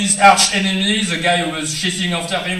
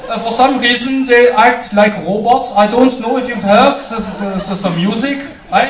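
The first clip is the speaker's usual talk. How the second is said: put-on higher, louder German-accented English; 7 semitones higher, 5 dB louder than usual. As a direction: straight and narrow mock-German delivery. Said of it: "it's a German accent".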